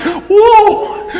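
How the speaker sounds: a whole congregation gasping and laughing at once; crowd's laughing whoa. Retold or imitated. Pastor imitating the church's reaction to the crack, high laughing whoa.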